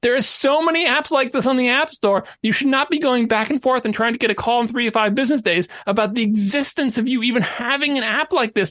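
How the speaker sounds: ordinary delivery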